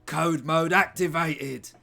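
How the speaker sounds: slow and loud, like a system alert; mock robot voice; robotic announcement. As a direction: boom it like a machine. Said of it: "He goes into code mode".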